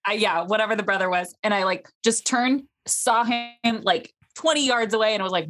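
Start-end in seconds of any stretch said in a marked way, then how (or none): none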